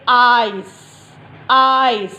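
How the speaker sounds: आइज said clearly with a long eye sound; आइज spoken slowly, the e kept silent; modelled word. Shown as a demonstration. Teacher models आइज for the class, clear and careful. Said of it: "अब third देखिए".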